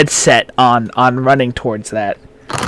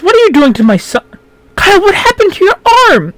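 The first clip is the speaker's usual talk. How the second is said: high, fast mother's voice; pitched-up woman panicking over her son; alarmed mother; 4 dB louder, faster than usual. Acted. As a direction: mom rushing in, demanding answers. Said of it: "and she goes".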